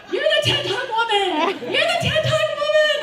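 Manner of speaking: high, loud shriek across a room; excited yelling. Retold or imitated. Overexcited fan charging at the speaker she spotted, high yelling. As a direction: yell it out in a freaked-out frenzy. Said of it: "and yelling".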